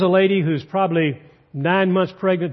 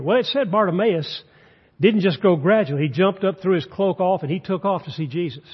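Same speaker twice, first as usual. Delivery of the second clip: ordinary talk, nothing marked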